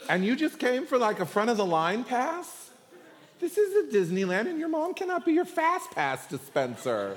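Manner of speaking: high-pitched, hammed-up outrage; indignant. The ten to the two brothers, indignant. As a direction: protest in mock disbelief, pitched well up. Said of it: "they were indignant with the two brothers".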